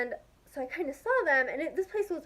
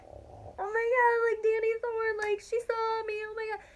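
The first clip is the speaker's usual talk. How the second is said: freaking out; 8 semitones higher than usual. Retold or imitated. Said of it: "I was, like, freaking out".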